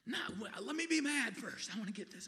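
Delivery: high, loud, hammed-up protest; stubborn mock outburst; mock angry refusal. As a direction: whine at full volume, refusing peace. Grudge-holder refusing Jesus.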